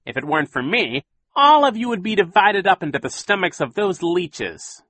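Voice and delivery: high, mocking villain voice rising on orphans; sneering villain. A narrator's reading. Captain Sham taunting the orphans, high and mocking. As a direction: taunt them like a smug pirate.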